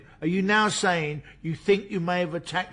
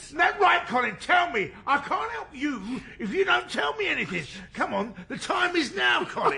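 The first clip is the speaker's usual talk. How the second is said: shouting demand; 10 semitones higher than usual. Acted. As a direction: hammer him for a confession. Detective shouting at suspect.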